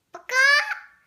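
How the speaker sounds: loud rising chicken baka; loud chicken squawk. Pretend. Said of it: "What does a chicken make?".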